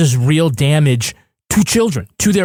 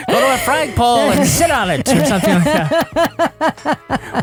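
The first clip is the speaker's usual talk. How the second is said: high, slow 1940s movie voice; old-timey insults; 6 semitones higher than usual. Pretend. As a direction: drawl it like an old film tough. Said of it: "Old-timey insults".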